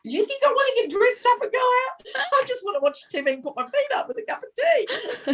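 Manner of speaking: high-pitched, hammed-up disbelief; mock incredulity. Worn-out mum to husband about going out, hammed-up incredulous. Acts out refusing to go out.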